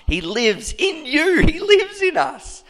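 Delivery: full-force preacher's cry; preaching climax. Real, not acted. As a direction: cry it out in rising fervor.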